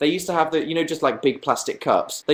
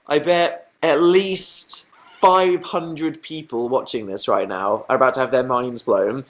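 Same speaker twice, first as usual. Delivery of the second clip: ordinary talk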